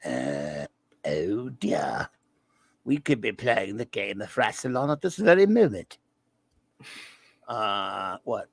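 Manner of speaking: prim android speaking slowly; deep mock robot; Crichton android voice. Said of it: "Crichton the android robot thingy".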